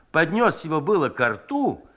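plain reading voice

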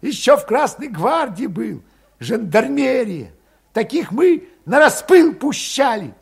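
high, loud, scornful dig; parting jab. A narrator's reading. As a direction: needle him on the way out, high. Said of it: "кольнул".

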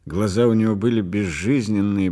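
plain reading voice

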